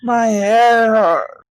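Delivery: a goblin nursing a headache after a rough night; hungover goblin. Acted. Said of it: "another goblin kinda, like, rubbing his head".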